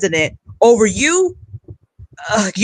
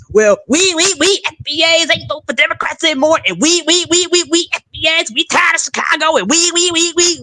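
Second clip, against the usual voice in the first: high-pitched mocking stutter; hammed-up high, stammering debater; Roland Martin; 7 semitones higher than usual. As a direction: imitate a worked-up pundit stuck on his words. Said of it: "he got that high pitched voice".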